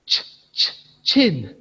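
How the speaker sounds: ordinary talk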